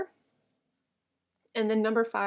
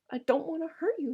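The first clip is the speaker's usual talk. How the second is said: higher-pitched, uneasy; anxious, pleading; 7 semitones higher than usual. A narrator's reading. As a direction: plead with her, no longer shouting. Lennie uneasy over her still body.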